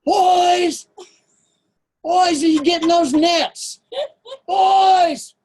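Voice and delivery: high, loud call across the woods; distant yelling. Retold or imitated. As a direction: holler for the boys from far off. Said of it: "you could hear the yells".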